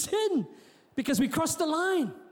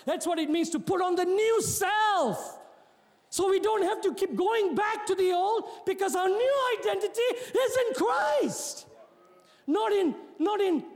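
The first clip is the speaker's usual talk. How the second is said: rousing preaching; 5 semitones higher than usual. Real, not acted. Preacher declaring a new identity in Christ, rousing. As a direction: build the declaration, stretching the last words.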